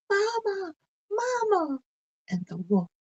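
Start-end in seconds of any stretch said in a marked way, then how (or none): 0.1-1.8 much higher and louder call for help; crying out. Acted. Blanche ducks from the wolf's jaws, crying out for Mamma. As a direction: cry out for her mother in fright.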